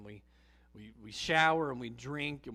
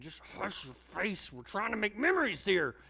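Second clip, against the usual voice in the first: fed-up parent, rising higher; exasperated parent; 7 semitones higher, 3 dB louder than usual. Retold or imitated. Driving parent telling bored kids to hush, exasperated. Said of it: "you're just saying".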